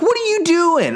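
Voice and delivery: high, disbelieving yelp at the wife; exasperated cry. Retold or imitated. Dad shocked the wife undercuts him, high disbelieving cry.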